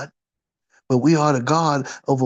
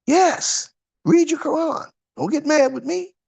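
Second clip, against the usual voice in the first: much higher-pitched, playful-sounding protest; high-pitched retort; 12 semitones higher than usual. Real, not acted. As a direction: push back in a raised, high voice. Preacher answers listeners' objection.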